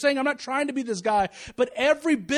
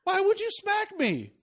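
high, slow, wounded protest; indignant daughter; 10 semitones higher, slower than usual. Retold or imitated. Daughter asking dad why he smacked her, high mock indignation. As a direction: imitate a child affronted at being smacked. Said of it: "crazy look on her face going".

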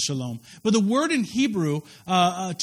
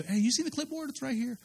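mock doctor; 6 semitones higher, 5 dB quieter than usual. Mocking the doctor.

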